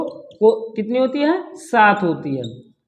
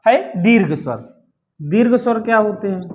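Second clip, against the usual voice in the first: usual speaking voice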